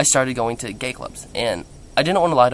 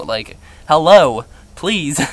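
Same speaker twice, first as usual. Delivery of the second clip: sassy pleading; 3 semitones higher, 8 dB louder, much slower than usual. Retold or imitated. Pleading with mom about cheerleading.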